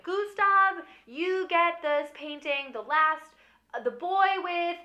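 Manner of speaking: high, loud formal proclaiming; announcing. Retold or imitated. Will reader announcing Gustav's inheritance, loud and formal. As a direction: read the bequest grandly to the room.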